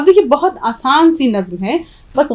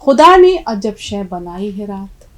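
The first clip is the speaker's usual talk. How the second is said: slow, louder recital of the line; slow verse quote; 3 semitones lower, 6 dB louder, slower than usual. Shown as a demonstration. Teacher quoting the poet's line again, slow and recited. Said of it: "अब यहाँ शायर ये भी कहता है".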